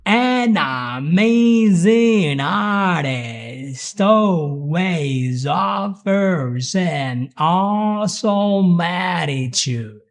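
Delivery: An amazing artist said very slowly, every word joined; slow linked demo. Shown as a demonstration. Teacher modelling linking slowly for learners. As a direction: stretch it out, no gaps between words. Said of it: "always connect every word to the next".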